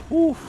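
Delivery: a high, sharp whew of relief after the escape; relieved whew. Real, not acted. Gamer escaping death in a tank battle, high relieved whew. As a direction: blow out the tension with a high whew.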